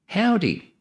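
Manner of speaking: high, falling Texas greeting; Texas howdy. Retold or imitated. Imitating recorded Texas elevator voice, Texas accent. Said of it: "with a real nice Texas accent".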